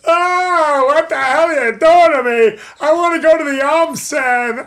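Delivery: high, loud, odd cartoonish voice; cartoon monster. Playing the Grumblezilla toy as a loud cartoon character. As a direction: ham up a grumpy cartoon creature. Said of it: "Mikey Godzilla persona".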